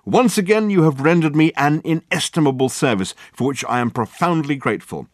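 loud, high theatrical gratitude; grandly grateful. Acted. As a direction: sweep out with lofty thanks. Dirk grandly thanks before leaving.

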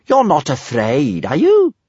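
slow taunt leaping high at the end; drawn-out teasing dare. A narrator's reading. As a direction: goad them, sliding up on the question. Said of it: "she said teasingly".

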